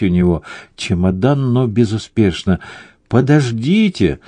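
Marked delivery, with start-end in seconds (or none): 3.1-4.2 higher-pitched, restrained call after someone; mild narrated shout. A narrator's reading. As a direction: call out to people walking away, lightly. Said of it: "закричал хозяин им вслед".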